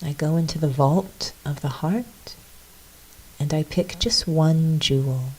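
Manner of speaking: soft, unhurried reflection with long pauses; guided meditation. Real, not acted. Leading listeners into a little reflection, soft meditation voice. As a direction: lead them inward quietly.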